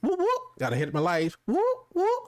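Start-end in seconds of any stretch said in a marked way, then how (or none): 0.0-0.4 very high vocal siren yelp; police siren whoop. Retold or imitated. Storyteller imitating the deputy's siren, high-pitched. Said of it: "That's the sound of the police". 1.5-2.2 very high vocal siren yelp; police siren whoop. Retold or imitated. Storyteller imitating the deputy's siren, high-pitched. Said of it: "That's the sound of the police".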